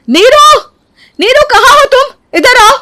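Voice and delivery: very high, loud angry summons; furious yelling. Acted. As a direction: yell for her across the house. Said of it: "गुस्से में लाल हो जाती है". (गुस्से में चिल्लाकर बुलाना)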